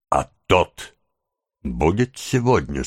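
"тот" set apart and leaned on, then a pause; stress on тот. A narrator's reading. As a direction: lean meaningfully on "тот" and hold before going on. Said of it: "снова выразительно подчёркивая местоимение".

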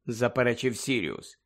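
ordinary delivery

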